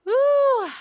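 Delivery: high whooping laugh. Real, not acted. Cohost cracking up at the pipe joke, laughing high. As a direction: let a big laugh burst out high.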